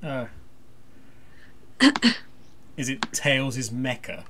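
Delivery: usual speaking voice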